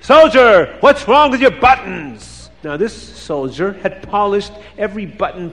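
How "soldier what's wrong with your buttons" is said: loud, high military bellow; screaming. A narrator's reading. Tsar finding fault with a soldier's buttons, screaming. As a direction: shout it at a soldier on parade. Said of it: "and the Tsar screams".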